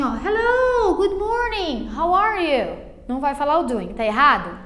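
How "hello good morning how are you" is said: higher-pitched, sing-out greeting; greeting someone. Acted. Person approaches the student to say hello, high-pitched greeting. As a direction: act out a person coming up to greet you. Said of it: "vai falar assim".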